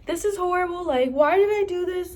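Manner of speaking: high-pitched mock wail; imitated distraught whine; retold bawling. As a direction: replay a tearful self-reproach, pitched high. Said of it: "I'm bawling my eyes out".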